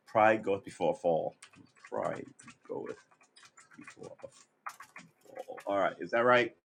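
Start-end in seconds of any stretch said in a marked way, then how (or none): 1.9-5.6 very soft, broken up with pauses; under the breath. Real, not acted. Repeats saying slowly under breath.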